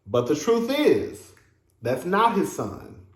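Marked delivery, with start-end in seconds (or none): none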